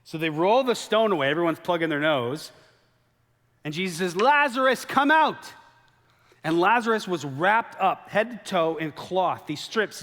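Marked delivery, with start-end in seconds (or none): 4.2-5.5 high, loud, drawn-out call. Acted. Jesus calling loudly into the tomb for Lazarus.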